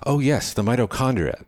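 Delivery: light up as it comes back; delighted recognition. A narrator's reading. Young man remembers his biology, delighted recognition.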